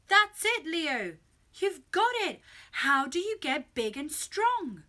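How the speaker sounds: loud, high burst of excitement; excited realisation. A narrator's reading. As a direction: exclaim as the answer suddenly dawns. Dad excitedly realises the answer.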